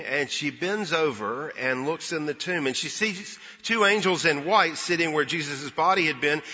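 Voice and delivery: ordinary talk, nothing marked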